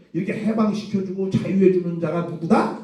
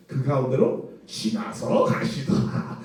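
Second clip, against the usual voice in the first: slow, hushed, reverent; solemn scripture reading; 5 semitones lower, 5 dB quieter, slower than usual. Shown as a demonstration. Preacher to congregation, reading the verse solemnly and slow. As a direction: read it like holy writ, almost breathed. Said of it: "거룩하게 표현해줘야 되니까".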